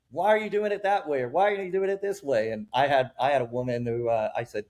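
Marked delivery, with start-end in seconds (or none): 0.1-2.5 high, mock-indignant questioning; a park know-it-all questioning the trainer; nosy bystander. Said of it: "they're like".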